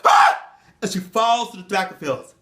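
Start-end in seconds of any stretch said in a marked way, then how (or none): 0.0-0.4 sharp high scream. Acted. Jenny screaming as the hatchet hits her. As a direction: cry out in pain.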